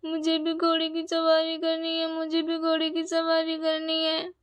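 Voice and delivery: put-on pleading little boy. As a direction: beg like a small child.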